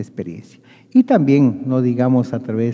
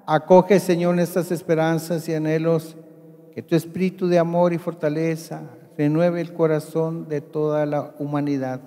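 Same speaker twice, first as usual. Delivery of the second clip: higher, solemn priestly prayer; 5 semitones higher than usual. Acted.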